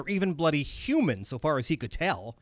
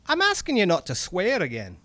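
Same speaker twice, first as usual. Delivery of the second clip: higher-pitched, reproving Scottish voice; Scottish accent; 6 semitones higher than usual. Acted. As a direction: admonish him in a Scottish accent. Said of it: "my bad Scottish accent comes in now".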